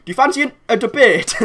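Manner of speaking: high, loud put-on invitation; imitated challenger. Host imitating the man who messaged him at night, mock challenge. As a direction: pitch it up as a sudden challenge.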